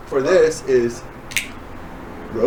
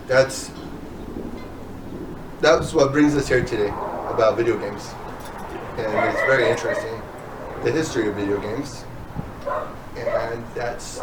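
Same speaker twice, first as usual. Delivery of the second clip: ordinary talk, nothing marked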